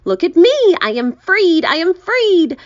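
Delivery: high, loud exclaiming; high triumphant shouting; jubilant cry. A narrator's reading. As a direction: proclaim freedom with glee. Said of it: "cried the centipede".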